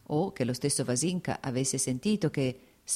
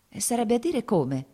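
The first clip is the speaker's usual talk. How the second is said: higher, louder, startled asking; surprised question; 8 semitones higher, 4 dB louder than usual. Acted. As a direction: guest caught off guard asks in surprise. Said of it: "cominciò Vasinka con sorpresa".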